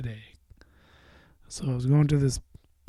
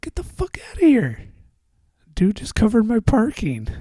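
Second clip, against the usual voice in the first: surprised; 9 semitones higher than usual. Retold or imitated. Disbelief at free parking.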